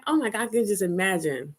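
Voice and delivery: usual speaking voice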